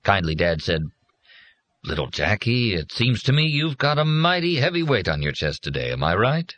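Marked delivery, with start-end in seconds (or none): 1.8-6.5 lightly voiced, higher, folksy fatherly tone; a hint of a folksy robot father; folksy counselor machine. A narrator's reading. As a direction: coax him to open up. Teaching machine coaxes Jack.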